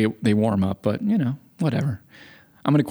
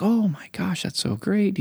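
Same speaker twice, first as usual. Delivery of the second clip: high, soft-spoken imitation of delight; polite neighbor's delight; 8 semitones higher, 3 dB quieter than usual. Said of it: "He was, like, so eloquent".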